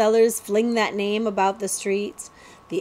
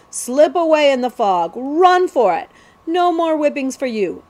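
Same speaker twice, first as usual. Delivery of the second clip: urgent self-urging; 5 semitones higher, 4 dB louder than usual. A narrator's reading. Jemmy urges himself to flee.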